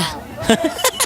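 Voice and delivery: high teasing laugh. Acted. Boy amused after startling her.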